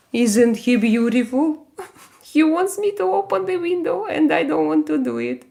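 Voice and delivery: high, light cooing voice; cooing over cat. Real, not acted. Streamer explaining what her cat wants, high cooing. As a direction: coo in a high pet-owner voice.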